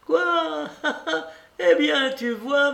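put-on very high, gloating old lady with a laugh; triumphant. A narrator's reading. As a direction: crow in victory, pitch way up. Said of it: "avec une indescriptible expression de triomphe".

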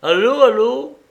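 voix déguisée, nettement plus haute et plus forte; intonation changée au téléphone. Acted. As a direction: maquiller sa voix en décrochant. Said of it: "changeant légèrement son intonation". (répondre au téléphone d'une voix contrefaite, plus aiguë)

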